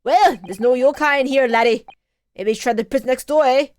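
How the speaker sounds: put-on loud, clumsy Irish accent; bad Irish accent. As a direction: belt it out in a badly faked Irish brogue. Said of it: "All these accents".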